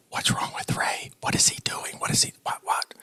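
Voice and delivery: whispered gossip among the women; whispering students. Retold or imitated. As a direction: whisper it like puzzled class members. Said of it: "everybody's talking amongst themselves".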